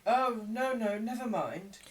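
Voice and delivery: high, loud hurried refusal; Nancy brushing off the crowd; young woman. A narrator's reading. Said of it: "said the young woman".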